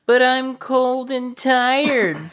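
very high, slow, whining moan; whiny high Thea voice. Acted. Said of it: "Thea moaned in protest".

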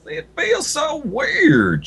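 drawn-out high weird voice; slow, high, puzzled; character voice. A narrator's reading. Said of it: "she remarked".

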